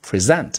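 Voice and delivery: verb present, preSENT with a stressed second syllable; 第二音节重读. Shown as a demonstration. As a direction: say pri-ZENT for present. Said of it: "重读在第二音节". (动词present重读后音节)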